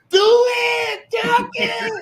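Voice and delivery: high shouted egging on; hype shout. Retold or imitated. Cheering on a friend's dunk, high excited shout.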